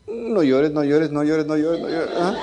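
un consuelo de broma, medio riendo; mock consuelo. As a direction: finge calmar a la hija con guasa. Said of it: "Y yo así". (consuelo burlón entre risas)

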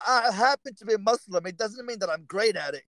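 retold plea to be left alone; exasperated retort. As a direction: protest to the whole car, half laughing. Retorting to seven questioners.

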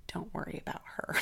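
imitated conspiratorial nurse; confiding nurse. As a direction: whisper it like a secret ally. Nurse confides behind doctor's back.

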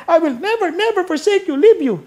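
put-on high, fast, excited child's voice; a grandchild bursting to tell Lola something. Grandchild eagerly telling Lola what Greek class taught, in a high excited child's voice.

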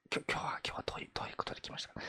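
rushed, hushed whisper; fast whisper. Pretend. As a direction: hurry the question in a whisper. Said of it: "小声で話せばいける。".

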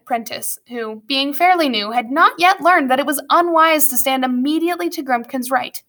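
ordinary delivery

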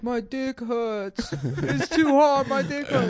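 put-on very high, drawn-out kid whine; whining five-year-old. Little cousin running around complaining, high mock whine. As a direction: imitate a little boy's loud complaint. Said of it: "when he was, like, five".

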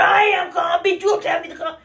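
high shout insisting she is calm; mock-furious shout at someone telling her to calm down; mad shouting. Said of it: "I am like that when I get mad".

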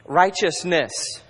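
slow, deliberate single word; stretched, weighty "Righteousness."; spat out. Shown as a demonstration. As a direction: drive the word "Righteousness." home slowly. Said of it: "I'm just gonna spit these at you".

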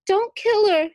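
high, loud desperate cry. A narrator's reading. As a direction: cry out to stop him. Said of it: "Cora cried".

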